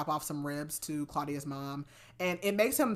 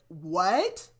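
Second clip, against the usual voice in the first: disbelief; 4 dB louder than usual. Real, not acted. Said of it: "I wish y'all could see my face".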